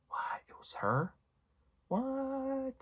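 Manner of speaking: hushed "It was her?" then a very high squeaked "What?"; disbelief. Retold or imitated. Vlogger reliving the moment Cecilia backs away, stunned disbelief. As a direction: gasp at the reveal in disbelief. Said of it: "pretty much verbatim my reaction".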